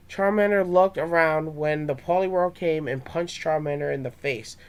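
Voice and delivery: plain reading voice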